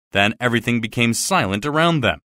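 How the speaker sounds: ordinary delivery, nothing marked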